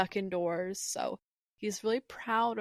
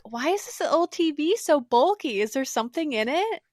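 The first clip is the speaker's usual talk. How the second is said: put-on lightly raised, wondering young girl; curious kid voice; 6 semitones higher, 5 dB louder than usual. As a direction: ask it like an amazed kid seeing old tech. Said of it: "And Liko's like".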